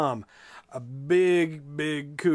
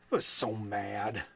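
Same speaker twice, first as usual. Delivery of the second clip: usual speaking voice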